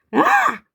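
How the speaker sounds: high loud frustrated squeal; frustrated shriek. Real, not acted. Vlogger fed up with footage, loud frustrated shriek. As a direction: let out an exasperated shriek.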